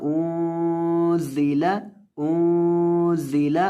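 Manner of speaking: انزل with the noon hidden and a hissing ز; slow انزل, nasal noon, sharp whistled ز; انزل nasal noon then whistling ز; ikhfa and whistling zay. Shown as a demonstration. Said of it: "نون کے اوپر اخفا کریں گے، زا میں سیٹی".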